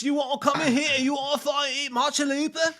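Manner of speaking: put-on loud, high drunk Scottish voice; bad Scottish accent, shouted. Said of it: "I can't do a Scottish accent".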